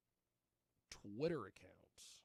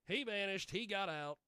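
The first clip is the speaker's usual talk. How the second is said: higher, louder mock-dramatic repeat; emphatic declaiming; 6 semitones higher, 5 dB louder than usual. Host on the missing guy, repeating it loud and high. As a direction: declaim it like a famous saying.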